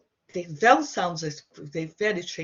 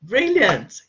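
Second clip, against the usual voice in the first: excited praise; 3 semitones higher, 11 dB louder than usual. Real, not acted. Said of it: "praising a student".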